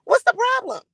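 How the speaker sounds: high squeaky mock version of the question. Streamer mocking her own question to chat in a squeaky voice.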